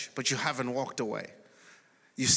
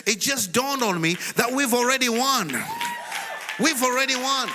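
high, loud preaching cry; exultant, pitched way up; triumphant shout; 9 semitones higher, 5 dB louder than usual. Real, not acted. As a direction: shout the breakthrough to a congregation. Preacher's climax to the congregation.

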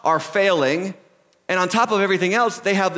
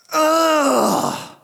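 high, drawn-out "ugh"; long exasperated groan; disgusted groan; 7 semitones higher than usual. Acted. Preacher reacting to news of beheadings, groaning in dismay. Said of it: "and it's just like".

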